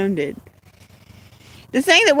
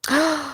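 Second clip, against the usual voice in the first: quick startled intake of breath; sudden quiet gasp of alarm; sharp gasp; 5 semitones higher, 6 dB quieter than usual. Real, not acted. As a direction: gasp in surprise. Spots something alarming.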